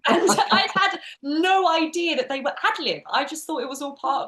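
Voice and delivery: high, loud, laughing through the words; amazed laugh at how much was ad-libbed; laughing disbelief. Real, not acted.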